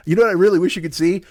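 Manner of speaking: ordinary delivery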